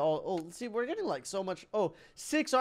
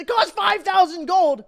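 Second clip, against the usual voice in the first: high, loud disbelief at the price; outraged exclamation; 10 semitones higher, 10 dB louder than usual. Real, not acted. Player sees the church's price, outraged yell. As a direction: yelp it as if being robbed.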